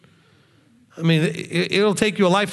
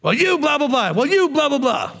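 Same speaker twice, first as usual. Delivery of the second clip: mock spousal bickering; 7 semitones higher than usual. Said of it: "the me fighting with the me".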